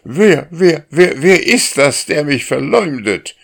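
high, loud startled cooing; cooing pigeon voice. A narrator's reading. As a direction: coo it out indignantly like a pigeon. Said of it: "gurrte der Täuberich".

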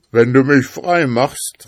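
loud, high bargaining voice; pleading spirit calling. A narrator's reading. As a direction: call out an urgent offer. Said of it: "rief der Geist".

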